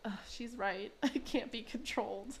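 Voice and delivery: softly groaned mock confession; lightly voiced resigned kid; Tina's admission. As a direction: give in with a quiet, put-on groan. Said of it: "And Tina is like".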